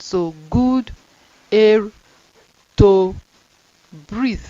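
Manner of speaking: slow dictation; word-by-word reading. Shown as a demonstration. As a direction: air and to punched loud. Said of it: "This is br".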